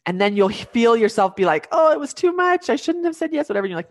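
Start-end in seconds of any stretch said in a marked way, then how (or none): 1.7-3.5 much higher, fretful self-doubt; fretful second-guessing. Pretend. A mom doubting herself after accepting help, fretful and pitched high. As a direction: panic that you asked for too much.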